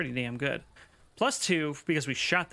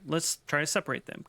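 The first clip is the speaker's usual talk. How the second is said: ordinary talk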